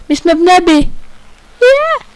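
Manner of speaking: excited. Real, not acted. Reaches heaven in the game.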